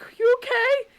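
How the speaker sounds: high, loud worried call. Retold or imitated. Husband calling to the silent neighbor kid, worried. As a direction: call up anxiously into the phone. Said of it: "My husband's like".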